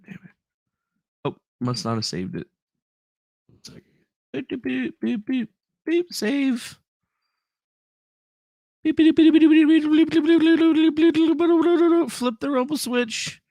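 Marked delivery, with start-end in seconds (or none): 4.3-13.4 high, monotone machine chatter; mock computer beeping. Streamer fixing the broken stream, high robotic beeping. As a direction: beep away like a busy little computer.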